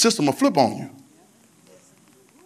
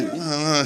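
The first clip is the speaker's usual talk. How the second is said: put-on toddler grunts. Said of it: "she want, she".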